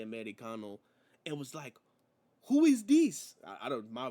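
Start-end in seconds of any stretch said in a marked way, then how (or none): 2.5-3.4 loud, high, badly imitated Spanish accent; mock Spanish-accented outburst; Penta impression, bad accent. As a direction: ask it baffled in a terrible Spanish accent. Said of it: "my accent is terrible".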